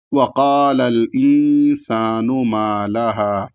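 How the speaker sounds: slow chant, final لَهَا drawn out one alif; Quran recitation. Shown as a demonstration. Teacher modelling the verse, slow chant. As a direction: recite evenly and hold the last vowel. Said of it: "اس طریقے سے".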